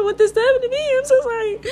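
high, laughing mock-sorry squeak; laughing high apology. Real, not acted. Vlogger apologizing to a killed bug, laughing high squeak.

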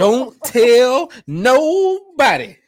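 high, drawn-out loud plea; mock-secretive outburst. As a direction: stretch it out high and loud, playfully. Jokey hushed-secret bit after sorry.

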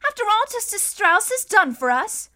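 put-on high, loud indignant girl; protesting cry. A narrator's reading. Violet protesting to Mister Poe about leaving. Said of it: "Violet cried".

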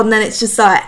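ordinary delivery

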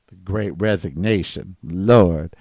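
tired, despairing mutter; weary groan. Real, not acted. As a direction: drop it down like you're fed up. Exasperated refrain of the rant.

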